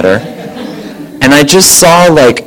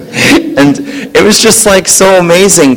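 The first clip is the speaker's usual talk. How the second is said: laughing; 6 semitones higher than usual. Real, not acted. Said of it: "laughing while telling".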